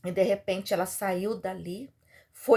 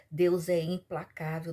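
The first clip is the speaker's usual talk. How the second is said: normal talking voice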